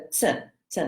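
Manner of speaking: "t, t" as two separate "ts" sounds; Czech C sound. Shown as a demonstration. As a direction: repeat "t, t" softly, each like "tsuh". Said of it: "T S".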